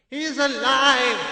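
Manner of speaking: high, slow joyful cry. A narrator's reading. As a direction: uncle overjoyed his nephew wakes. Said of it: "he gave a cry of joy".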